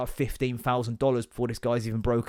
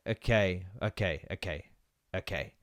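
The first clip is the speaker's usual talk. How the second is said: quiet resigned okays; 6 semitones lower, 8 dB quieter than usual. Retold or imitated. To the clerk, a defeated quiet okay. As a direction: mutter the okays, much softer and lower.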